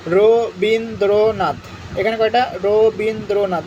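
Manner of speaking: Rabindranath drawn out syllable by syllable; Rabindranath stretched out, ro-bin-dro-nath; slow syllable count. Shown as a demonstration. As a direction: say Rabindranath slowly so each syllable is heard. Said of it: "counting syllables slowly".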